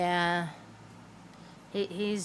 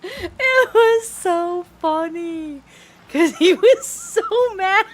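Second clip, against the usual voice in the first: high, loud, slow words squeezed out through laughing; can barely speak for laughing; helpless laughter; 14 semitones higher, 11 dB louder, slower than usual. Real, not acted. Telling the viewer about Beef's anger, helpless laughter. Cracking up retelling the story.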